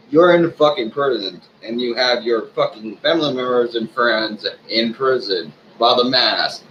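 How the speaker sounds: normal talking voice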